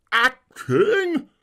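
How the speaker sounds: very high outraged scream. Acted. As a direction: shriek the word back at her. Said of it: "screamed the tragedian".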